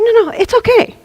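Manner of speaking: high, airy helper voice; reassuring helper. Acted. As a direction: gently contradict in a high put-on voice. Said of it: "and she's like".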